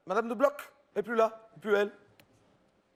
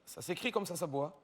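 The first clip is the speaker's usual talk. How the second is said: usual speaking voice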